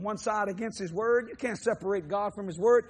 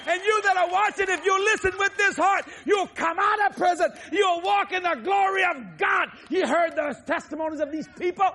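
shouted, much higher and louder sermon; fiery revival preaching; full-force preaching; 10 semitones higher, 4 dB louder than usual. Real, not acted.

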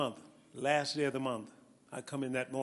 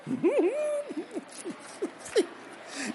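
high-pitched delighted laugh. Real, not acted. Preacher laughs at reaction.

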